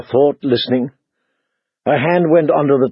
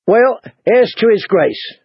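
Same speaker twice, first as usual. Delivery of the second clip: called out cheerfully; 8 semitones higher than usual. Acted. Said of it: "called out cheerfully".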